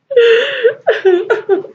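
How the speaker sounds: high giggle; high laugh. Real, not acted. Laughs after singing.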